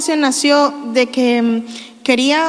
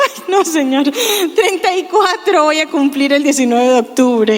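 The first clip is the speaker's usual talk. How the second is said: laughing; 3 semitones higher than usual. Real, not acted. Correcting her own age, laughing.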